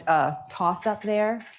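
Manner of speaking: ordinary talk, nothing marked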